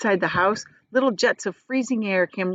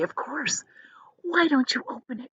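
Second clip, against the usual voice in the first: higher unvoiced whisper; 6 semitones higher, 5 dB quieter than usual. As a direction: urge him in a hush. Charlie whispering.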